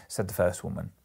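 ordinary delivery

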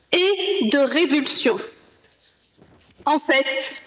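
usual speaking voice

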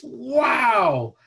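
high, loud impressed "Wow". Shown as a demonstration. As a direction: gasp out an amazed wow. Demonstrating praise, a well-placed wow.

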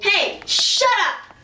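high, drawn-out "shut up" at hecklers; irritated retort. Acted. Host snaps back at hecklers.

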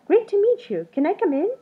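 high, loud, bubbly creature voice; an eager little creature bursting with friendliness; cheery creature. A narrator's reading. Creature greets Something Else at the door, high and eager. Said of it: "said Something Else".

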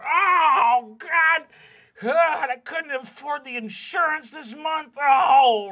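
high, loud, panicked shout; distraught dwarf dad. Acted. Dwarven dad yelling over his destroyed house. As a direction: fret loudly about the insurance.

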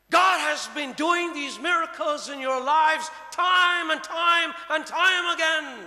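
loud, high and slow; preaching climax. Acted. Preacher rousing the church, loud and high. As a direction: lift it up and hammer each time.

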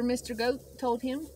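plain reading voice

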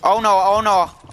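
urgent callout. Real, not acted. Gamer yelling to his teammate that the enemy is above. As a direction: call it out fast to warn him.

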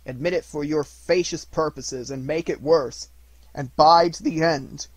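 loud, rising, bitter spirit; scornful ghost. Acted. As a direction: fling the words at him.

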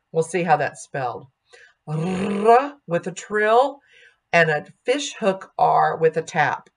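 normal talking voice

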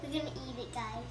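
quiet mumble. Real, not acted. About to eat jelly beans.

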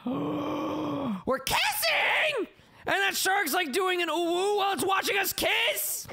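shocked high squeal. Real, not acted. Shocked at kissing characters.

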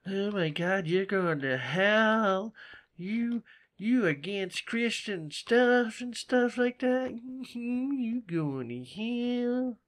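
high, slow, horrified pearl-clutching voice; hammed-up scandalized believer, dragging the words out; mock shocked churchgoer. Imitating a scandalized Southerner scolding her for swearing, high and slow. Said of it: "it's like".

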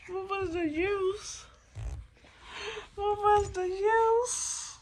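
high, slow, drawn-out plea; high slow anguished whine; despairing whine. Real, not acted. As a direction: wail the question in despair. Stuck on a game, despairing.

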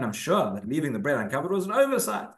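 ordinary talk

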